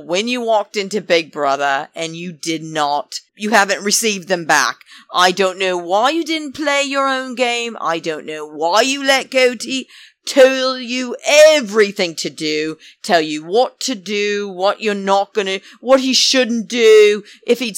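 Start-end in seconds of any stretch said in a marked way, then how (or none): none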